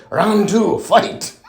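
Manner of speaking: imitated fight-game announcer; mock-epic announcer call; Mortal Kombat. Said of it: "in my best Mortal Kombat voice".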